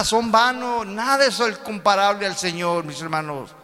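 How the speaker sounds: loud, high, fervent sermon; preaching at full force. Acted. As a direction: preach it out to the congregation. Preaching climax.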